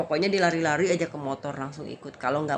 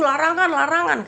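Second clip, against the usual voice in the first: high, loud mock warning; raised, hammed-up voice; imitated insistent shout; 11 semitones higher, 8 dB louder than usual. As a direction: act out someone shouting about a rule. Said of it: "katanya gitu ya".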